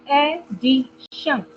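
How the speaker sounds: Addition slowly, in three separate syllables. Shown as a demonstration. Teacher giving another example, addition split by syllable. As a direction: break addition twice with clear pauses. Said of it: "three parts को अलग-अलग बोलना पड़ रहा है".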